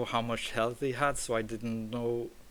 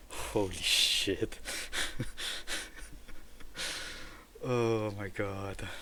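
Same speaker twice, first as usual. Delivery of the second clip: stunned relieved laugh; 3 dB quieter, slower than usual. Real, not acted.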